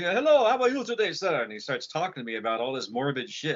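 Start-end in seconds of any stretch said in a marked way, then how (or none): 0.0-1.4 high, put-on French accent. Giant stranger greeting a neighbor, French accent. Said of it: "with this French accent".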